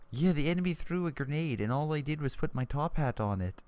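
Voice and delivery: put-on terrible Canadian accent. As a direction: badly fake a Canadian accent. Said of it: "that was a terrible accent. Sound like a Canadian".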